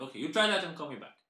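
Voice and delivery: rattled off fast to end the call; rushed brush-off. Acted. Call-centre worker brushing off a caller, fast. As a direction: hurry the caller off.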